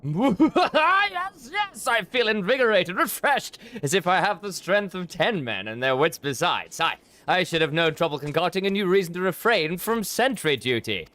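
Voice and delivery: lazy nobleman delighting in his new excuse; gleeful noble. Acted. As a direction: crow about feeling invigorated. Voicing a revived, gleeful noble.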